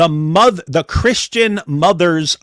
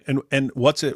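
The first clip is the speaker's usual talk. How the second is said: ordinary delivery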